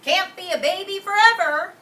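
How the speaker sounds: high, loud nosy neighbor; a bossy neighbor scolding over the fence; Missus Tweezers. Acted. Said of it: "said Missus Tweezers".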